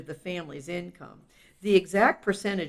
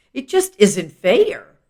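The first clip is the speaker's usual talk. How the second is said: plain reading voice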